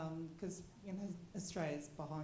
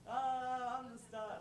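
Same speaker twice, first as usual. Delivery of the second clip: mock prima donna; 6 semitones higher, slower than usual. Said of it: "like a prima donna".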